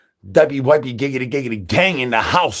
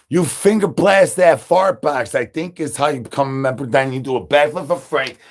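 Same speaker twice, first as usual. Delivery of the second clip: usual speaking voice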